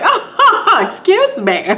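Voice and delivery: high, mock-offended little laugh; imitating a sassy, laughing retort; laughing with attitude. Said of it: "She even had a little laugh".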